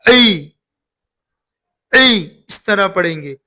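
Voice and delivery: "ऐ" squeezed out of the throat for ain; teacher demonstrating the Arabic ain in "ऐ". Shown as a demonstration. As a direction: tighten the throat on "ऐ". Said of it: "इसको गले से निकाल कर".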